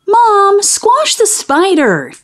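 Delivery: high, drawn-out cry for Mom; screamed plea. A narrator's reading. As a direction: call out in storybook alarm, high and slow. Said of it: "screamed Billy".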